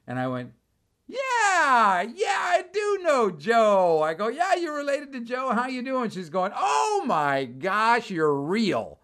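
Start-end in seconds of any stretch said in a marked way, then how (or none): 1.1-4.0 high, loud, drawn-out yeah; delighted recognition. Retold or imitated. 4.3-6.1 high-pitched, eager chatter; excited greeting. Retold or imitated. Excited greeting of Joe's relative. 6.5-9.0 high, very drawn-out exclamation; overjoyed amazement. Retold or imitated. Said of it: "This was the happiest woman I've ever talked to".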